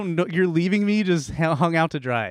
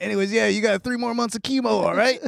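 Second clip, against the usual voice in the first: higher, breezy bro doctor; frat bro; 6 semitones higher than usual. Retold or imitated. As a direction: sign off like a chill bro. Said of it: "what the guy sounded like".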